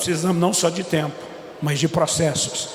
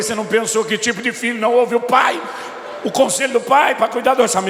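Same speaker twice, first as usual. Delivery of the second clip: father scolding his son, voice raised; indignant; 5 semitones higher than usual. Retold or imitated.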